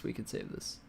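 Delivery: whispered to himself; whispering. Real, not acted. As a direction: breathe it out under your breath. Repeats phrase, fading to whisper.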